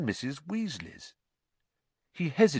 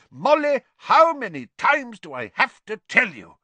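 loud, high, frustrated shout; exasperated outburst; 14 semitones higher, 10 dB louder than usual. A narrator's reading. Father after banging the table, loud exasperated shout. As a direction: slam it home in exasperation.

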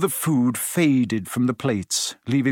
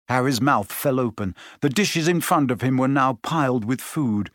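usual speaking voice

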